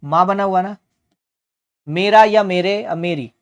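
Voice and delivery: ordinary talk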